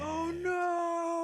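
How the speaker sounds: very high drawn-out cry of disbelief; scandalized dismay. Real, not acted. Co-host absorbing the daughter reveal, scandalized dismay. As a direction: wail in delighted horror, way up high.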